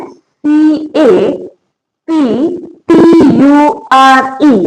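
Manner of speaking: slow letter-by-letter spelling; name each letter separately, drawn out; spelled out slowly. Shown as a demonstration. Teacher spelling a word for learners, slowly letter by letter.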